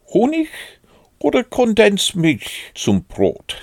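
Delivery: very high-pitched storybook Rabbit; Rabbit offering food. A narrator's reading. Rabbit offers Pooh a snack, high-pitched character voice.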